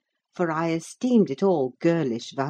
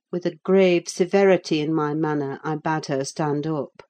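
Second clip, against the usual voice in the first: ordinary delivery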